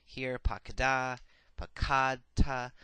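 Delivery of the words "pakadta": "pakadta" with kad stressed, loud and long; slow "pakadta", leaning hard on the middle syllable kad; stress on second syllable. Shown as a demonstration. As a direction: punch the kad in "pakadta", leaving ta light. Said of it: "the accent has retracted to the second syllable".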